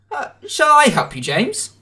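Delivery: a hint of gentle Edward; kindly Edward. A narrator's reading. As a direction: call out helpfully. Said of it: "called Edward".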